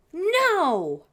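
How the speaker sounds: high voiced question then a loud, high no; spoiled children's refusal; spoiled kids' no. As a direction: pout out the answer like spoiled children. Kids refusing in story.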